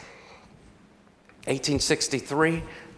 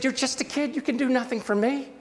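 much higher-pitched mock protest; exasperated retold rebuff; 11 semitones higher than usual. Old man brushing off the kid, high-pitched exasperated retelling.

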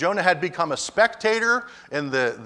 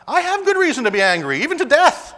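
louder, higher angry retort; defiant Jonah; 9 semitones higher, 7 dB louder than usual. Acted. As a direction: snap back at God in defiance. Jonah talking back to God.

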